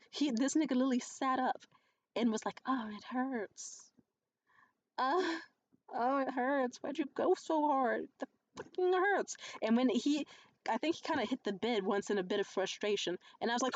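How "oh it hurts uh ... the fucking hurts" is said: imitating a hurt man's whimper; exaggerated pained complaint, climbing higher; mock whining. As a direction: whine it slowly as if sore, mocking him. Mimics beaten man complaining of pain.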